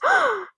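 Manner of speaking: high, audible stage gasp; shocked gasp. Acted. Josh Maya hearing the confession, shocked gasp. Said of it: "Josh and Maya gasps".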